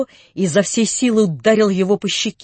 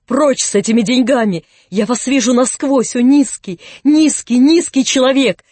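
higher, louder outraged cry; furious shout; 5 semitones higher, 7 dB louder than usual. A narrator's reading. Natasha throws out the prince, shouting in fury. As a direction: hurl the insult at him. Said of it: "закричала Наташа".

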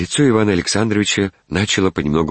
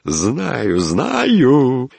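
high, climbing repeat; triumphant, rising self-satisfaction; gleeful knowing; 9 semitones higher, 4 dB louder than usual. A narrator's reading. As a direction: sing it out gleefully, second word higher and louder. Gleeful recognition of the admirer.

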